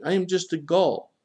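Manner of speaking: high, helpless protest; high-pitched, beseeching tone; pleading. A narrator's reading. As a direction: plead your own smallness.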